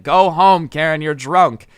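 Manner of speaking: loud repeated order; imitated screaming. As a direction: yell at her to clear off. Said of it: "screaming over and over again".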